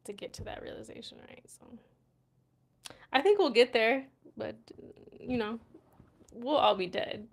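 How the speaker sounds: ordinary talk, nothing marked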